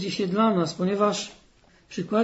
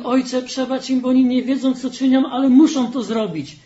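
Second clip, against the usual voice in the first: raised, higher urgent prayer; fervent plea; 5 semitones higher, 7 dB louder than usual. Acted. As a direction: cry it out as a pleading prayer. Preacher voices Jesus praying on cross. (żarliwa, głośna modlitwa)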